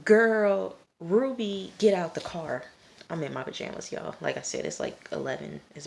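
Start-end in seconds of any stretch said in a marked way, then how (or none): 0.1-2.6 louder, higher exasperated call; exclaiming. Acted. Viewer scolding Ruby mid-recap, exclaiming. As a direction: call out to a character on screen.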